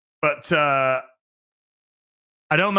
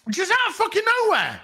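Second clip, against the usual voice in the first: high, fast shout of disbelief; incredulous outburst; faster than usual. Real, not acted. Telling chat about the surprise character, yells in disbelief. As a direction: blurt it out, still unable to believe it.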